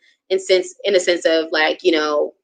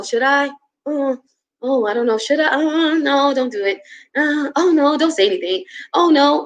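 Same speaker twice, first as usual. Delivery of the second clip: higher, fretful second-guessing; anxious inner voice; 7 semitones higher than usual. Retold or imitated. Reliving moments of self-doubt, higher anxious inner voice. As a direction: voice the nervous doubts in your head, pitched up. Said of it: "I still have those moments where I feel like".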